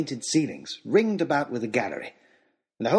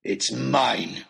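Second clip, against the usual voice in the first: parting threat. A narrator's reading.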